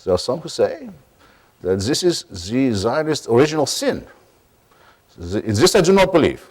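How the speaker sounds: a gentle imitation of Avishai's accent; mild Israeli accent impression. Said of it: "I'll try his voice".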